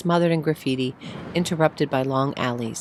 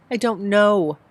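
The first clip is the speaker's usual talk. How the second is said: crying; 6 semitones higher, 4 dB louder, slower than usual. Acted. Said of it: "stop that crying".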